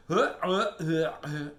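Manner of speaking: short, loud grunted yelps; bear's yell. Acted. Bear sprayed in the face, grunting yells. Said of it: "the bear yelled".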